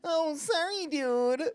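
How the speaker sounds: squeaky timid apology; Hootie voice. Acted. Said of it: "your eeny meeny voice".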